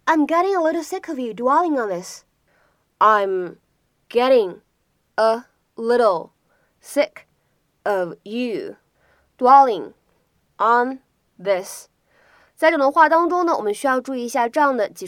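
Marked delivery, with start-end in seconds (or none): 3.0-11.9 slow, with a clear pause after each short chunk; separated words, each given its own beat. Shown as a demonstration. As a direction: dictate it piece by piece for learners. Teacher breaks sentence down word by word.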